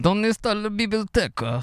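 high-pitched fake Italian accent; mock Italian accent. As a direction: ham up a tourist's Italian accent. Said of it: "you've been living in Italy".

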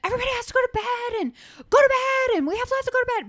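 put-on high, loud panicked child shouting; frantic kid yelling. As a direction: yell it like a frantic little boy on Christmas Eve. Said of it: "he's screaming at us".

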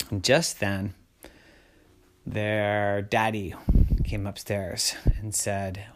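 plain reading voice

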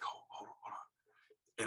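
hushed, whispered halt; urgent whisper. Retold or imitated. Dad stopping the shot in the stand, imitated whisper. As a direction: imitate a hunter whispering to stop.

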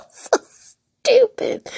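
squeaky high laugh into the words; so stupid, squealed through laughter. Real, not acted. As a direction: laugh so hard the voice goes high. Laughing at himself.

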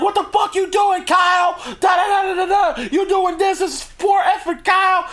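imitating an angry fan yelling at his screen; high-pitched, hammed-up rant at the TV; mock yelling. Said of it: "yelling at his screen".

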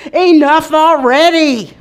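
loud, slow, high and fed up; exasperated plea. Retold or imitated. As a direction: cry it out to God, worn thin. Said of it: "uttering to God".

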